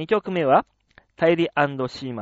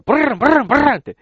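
loud, higher brrr of startled horses; horse snort imitation; 8 semitones higher, 7 dB louder, much faster than usual. (驚いた馬のいななきの真似)

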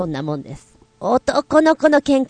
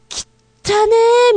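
much higher, slow drawn-out gross; disgusted; 13 semitones higher, slower than usual. Shown as a demonstration. Teacher shows how the girl recoils from dad, drawn-out and disgusted. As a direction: recoil like he's filthy. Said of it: "みたいな顔してくださいって".

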